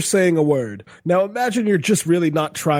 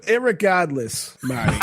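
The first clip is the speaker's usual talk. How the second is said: put-on Boston accent. Showing friends why irregardless sounds good, Boston accent. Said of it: "so good in a Boston accent".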